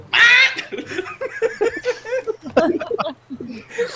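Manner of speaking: long, high squawking laugh; squawking bird cackle. Acted. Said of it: "so I just go".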